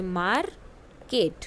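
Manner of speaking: drawn out, every syllable clear; slow model word. Shown as a demonstration. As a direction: model it slowly for the class. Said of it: "listen to your teacher and say the following word". (धीरे-धीरे, हर अक्षर साफ़ "market")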